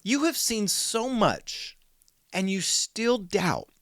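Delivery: frustrated. Retold or imitated. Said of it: "he's frustrated because he's going".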